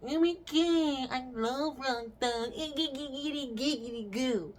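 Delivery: high goofy fanboy voice; mock excited fan. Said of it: "he was like".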